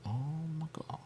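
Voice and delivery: breathy mock gasp in a whisper. Retelling her own reaction, whispered. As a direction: hush it like a shocked aside.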